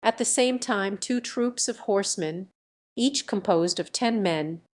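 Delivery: ordinary delivery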